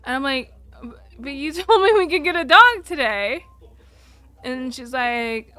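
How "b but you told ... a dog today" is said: imitating her teary younger self, higher and louder; a mock sob-stammer, half laughing through it; retold crying plea. Daughter to mom at the shelter, hammed-up teary protest. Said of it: "crying at this point".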